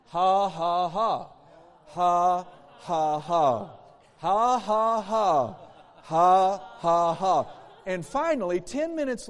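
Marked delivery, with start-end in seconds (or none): 0.1-7.5 loud, deliberate rounds of fake ha-ha-ha; put-on laughter in measured bursts; forced laugh. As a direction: push out each ha evenly, laughing by sheer will. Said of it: "He said he just put it on".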